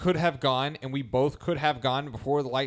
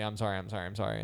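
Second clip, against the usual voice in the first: softer, lower repeated sorry; softer apology; 5 semitones lower, 8 dB quieter, faster than usual. Retold or imitated. Driver meekly repeating his apology to the woman, soft. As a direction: let the apology fade, meek. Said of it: "like, immediately".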